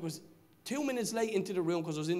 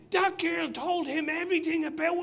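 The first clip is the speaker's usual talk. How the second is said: shouting out; 12 semitones higher, 6 dB louder than usual. Retold or imitated. Man outside the pub shouting out the commotion, high excited shout. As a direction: holler the amazing news to the whole street. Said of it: "another man shouting out".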